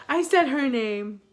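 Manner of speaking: loud, drawn-out groan of realisation; dismayed outburst. Real, not acted. Storyteller realises she named her friend, loud dismay.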